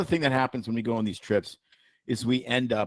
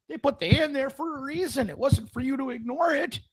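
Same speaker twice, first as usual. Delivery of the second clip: high, pompous mock lecture on caramels; high-pitched lofty sermon on living; mock lecturing voice; 13 semitones higher than usual. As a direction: preach it way up high like a pretentious philosopher. Mock philosopher rant voice.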